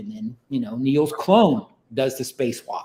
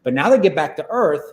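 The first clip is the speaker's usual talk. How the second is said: ordinary delivery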